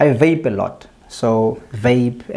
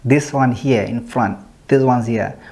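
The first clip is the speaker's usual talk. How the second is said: lip pulled down while talking; showing teeth with finger. Real, not acted. Seen 0: Finger holds lower lip down, teeth shown throughout the words.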